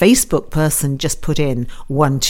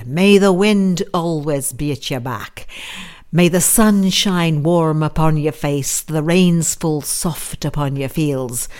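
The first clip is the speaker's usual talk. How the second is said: put-on Irish accent slipping into Scottish. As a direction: recite it in an amateur Irish lilt, drifting Scottish. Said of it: "that Irish accent probably slipped into Scottish".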